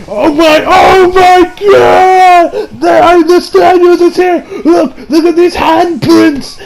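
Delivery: high, loud, long-stretched alarm cry; hammed-up panicked shout. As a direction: wail it like an old man losing it. Joseph acted out, frantic over handprints.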